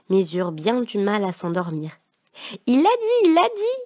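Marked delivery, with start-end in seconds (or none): 2.7-3.8 very high, gleeful squeal; girl jubilating to her brother; jubilant. A narrator's reading. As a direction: crow with delight like a little girl. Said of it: "jubila Marie-Parlotte".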